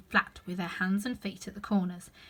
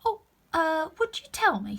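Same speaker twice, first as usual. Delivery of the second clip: timid; 8 semitones higher, 5 dB louder than usual. Acted. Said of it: "said Alice a little timidly".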